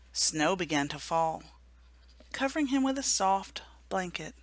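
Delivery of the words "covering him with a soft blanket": ordinary delivery, nothing marked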